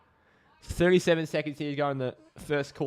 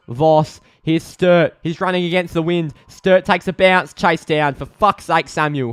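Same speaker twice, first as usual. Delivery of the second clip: loud, raised-pitch urgent commentary; real frustrated fan commentary, much louder; exasperated match call; 6 semitones higher, 12 dB louder, slower than usual. Real, not acted. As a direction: urge the runner on, then despair at him. Exasperated call as player is caught.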